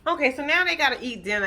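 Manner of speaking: normal talking voice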